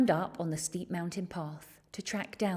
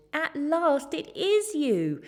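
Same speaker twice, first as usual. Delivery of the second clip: slow, higher, louder joyful cry; delighted; 9 semitones higher, 6 dB louder, slower than usual. A narrator's reading. Boy finally finding the Yeti, slow loud joy. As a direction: stretch out each word in relief. George relieved to find Yeti.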